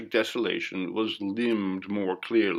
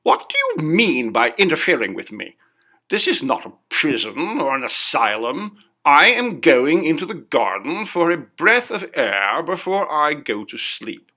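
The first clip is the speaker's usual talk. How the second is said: higher, louder, furious protest; ferocious outburst; 5 semitones higher, 6 dB louder than usual. A narrator's reading. Guest turns on the waiter blocking the garden, ferocious outburst. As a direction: lash out at the meddling waiter. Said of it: "with a gesture of ferocity".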